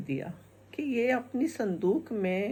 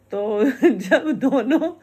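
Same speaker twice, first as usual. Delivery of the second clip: real laugh, louder, slow; 3 semitones higher, 8 dB louder, slower than usual. Real, not acted. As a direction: break up laughing mid-story. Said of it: "तो ये किस्सा था".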